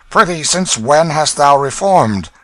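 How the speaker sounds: loud, high, slow scoffing question; mocking thief. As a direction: needle him with false wonder. Hugo mocks the king.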